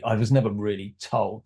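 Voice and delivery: ordinary talk, nothing marked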